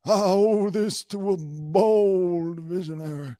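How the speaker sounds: high, loud, stretched-out BOLD; hammed-up grand orator; booming character speech. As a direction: boom it out with hammed-up grandeur, slowly. Mock in-character Oscar speech, booming.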